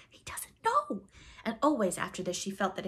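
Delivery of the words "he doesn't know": very high, shocked little outburst; shocked exclamation. A narrator's reading. Wendy shocked at the ignorant pirate, high exclamation. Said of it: "so shocked that she exclaimed".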